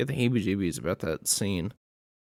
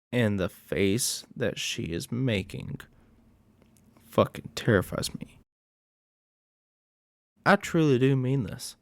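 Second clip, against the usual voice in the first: ordinary delivery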